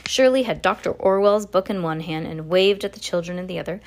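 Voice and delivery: ordinary delivery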